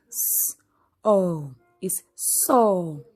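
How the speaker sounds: "S-O is so", spelled then blended into "so", slow and breathy; sounding out slowly. Shown as a demonstration. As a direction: blend the letters into the word, very slowly. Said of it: "let's sound this word".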